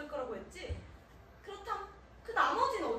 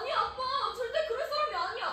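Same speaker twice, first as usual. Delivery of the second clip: higher-pitched, insistent denial; urgent protest; 7 semitones higher, 3 dB louder than usual. Acted. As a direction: plead hotly in someone's defence. Daughter protesting father's accusation.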